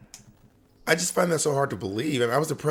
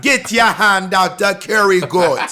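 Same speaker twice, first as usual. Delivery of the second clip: louder, slow hammed-up West Indian accent; caricature West Indian accent; 6 semitones higher, 8 dB louder, slower than usual. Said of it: "now that's a caricature".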